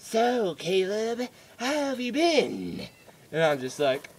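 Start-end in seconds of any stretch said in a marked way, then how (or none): 0.1-2.9 slow, high, drawn-out sinister greeting; mock ominous dentist; creepy doctor. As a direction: stretch it out like a villain toying with a patient. Creepy doctor addresses patient.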